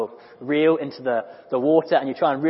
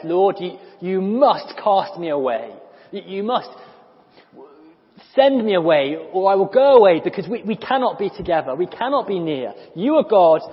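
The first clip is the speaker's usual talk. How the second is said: loud pleading Simon, raised pitch; anguished plea; 5 semitones higher, 7 dB louder than usual. Acted. Simon begging Jesus to send him away, loud anguish. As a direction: cry out to be sent away.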